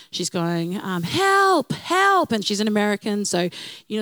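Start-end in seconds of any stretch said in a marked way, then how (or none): 1.0-2.3 high-pitched imitating call for help; mild mock scream of a frightened woman; mock cry for help. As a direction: echo the panicked tourist's cry, pitched up. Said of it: "she's kinda screaming".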